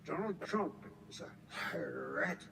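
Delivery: mocking impression, low, soft and slow; Joe Biden. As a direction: drag out the last word in mockery of an opponent. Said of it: "He always says".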